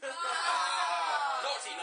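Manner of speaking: high, loud hammed-up telling-off; squealing mock reprimand; mock scolding. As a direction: wag a finger and shout naughty.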